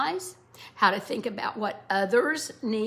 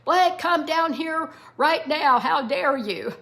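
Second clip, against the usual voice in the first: acted dad's yell, high and loud; a father yells up the steps at his son, mock angry; 5 semitones higher, 6 dB louder than usual. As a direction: call up the stairs like an angry father. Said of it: "Dad is yelling at the bottom of the steps".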